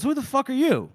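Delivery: high-pitched retold demand; mock indignant challenge. Woman confronts a stranger at the door, mock indignant.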